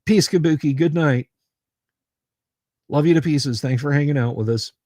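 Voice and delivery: usual speaking voice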